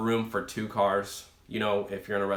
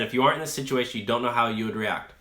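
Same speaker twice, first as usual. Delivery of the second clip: usual speaking voice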